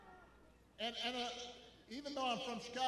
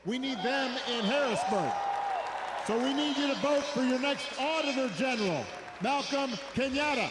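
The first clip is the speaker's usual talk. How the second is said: rally speech; 4 semitones higher, 7 dB louder than usual. Real, not acted. Rally call for candidates.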